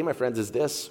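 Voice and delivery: ordinary talk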